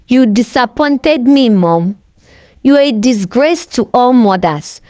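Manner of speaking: ordinary delivery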